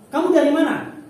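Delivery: loud, high blunt question; NTT questioner. Retold or imitated. NTT person asking a Javanese where they're from, loud and high. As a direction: fire the question out so it sounds angry. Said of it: "Kalau orang N T T".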